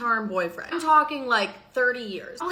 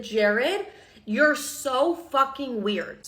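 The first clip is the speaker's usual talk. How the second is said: "you're so fucking weird" drawn out word by word; slow put-down; 2 semitones higher, much slower than usual. Retold or imitated. Setting creepy Jared straight, slow and deliberate. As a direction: spell it out to him slowly, unimpressed. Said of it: "set him so straight and be like".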